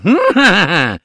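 boastful booming laugh; gloating elephant laugh. Acted. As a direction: laugh like you own the jungle. (risata tronfia dell'elefante)

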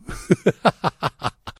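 high, stuttering laugh; drunken cackle. A narrator's reading. Drunk professor after his French ditty, cackling. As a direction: cackle in a rapid string.

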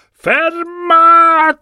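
high roaring shout, drawn out; monster's shout. A narrator's reading. Sea monster Tifeo ordering his beasts, roaring shout. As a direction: bellow it like a raging titan. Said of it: "e gridò".

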